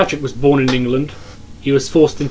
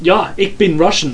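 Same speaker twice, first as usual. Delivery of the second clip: loud, higher put-on Russian accent; mock Russian accent; 5 semitones higher, 5 dB louder than usual. Co-host joining the accent game, hammed-up Russian accent. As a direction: ham up a bad Russian accent. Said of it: "I can do the Russian accent".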